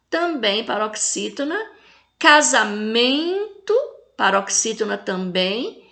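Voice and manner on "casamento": "Casamento" slowly, MEN drawn out; teacher's long stress on MEN in "Casamento"; tonic syllable stressed. Shown as a demonstration. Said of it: "fala com mais intensidade".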